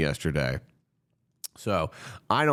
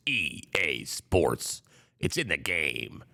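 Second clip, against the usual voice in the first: announcer impression; 5 semitones lower, 6 dB quieter, slower than usual. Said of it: "EA Sports slogan".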